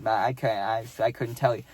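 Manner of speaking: ordinary delivery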